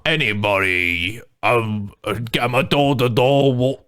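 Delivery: low, slow mock British sales pitch; lightly put-on British accent; hint of a British peddler; British door to door seller. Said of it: "practice our British accents".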